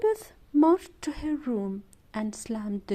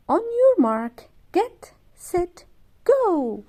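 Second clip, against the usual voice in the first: high, loud race countdown; excited start call; 7 semitones higher, 6 dB louder, slower than usual. A narrator's reading. Big sister starting a toy race, high and loud. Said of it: "said Elizabeth".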